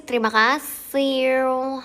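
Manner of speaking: loud, high, drawn-out cutesy thanks; over-the-top cute thank-you; playfully cutesy. Pretend. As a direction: stretch it out big and cute. Playing cute after "sok imut" remark.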